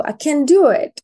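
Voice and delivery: a hint of a frustrated toddler; upset small child. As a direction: voice a little child admitting defeat. Voicing a child naming feelings.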